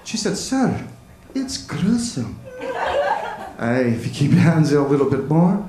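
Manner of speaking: storytelling in a put-on Scottish accent. Comic tells his kilt story to the crowd in Scottish accent. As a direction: spin a yarn as a Scotsman. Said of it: "tell a story in a Scottish accent".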